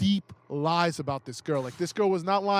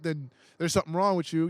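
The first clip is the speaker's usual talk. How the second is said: ordinary talk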